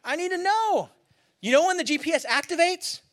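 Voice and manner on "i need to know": much higher and louder, demanding praise; yelling at the GPS. Pretend. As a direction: shout at Siri for reassurance. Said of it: "You're like".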